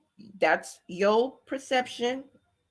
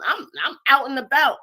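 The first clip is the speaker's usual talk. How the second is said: louder, heated and breaking; rising to a fast angry burst; heated upset; 3 semitones higher, 7 dB louder than usual. Real, not acted. Streamer heated at the host for removing the video.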